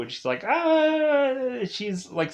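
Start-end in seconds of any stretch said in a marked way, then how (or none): 0.5-1.6 high mock scream of pain; a short, high mock scream. Narrator imitating Kana screaming in pain, a high mock scream.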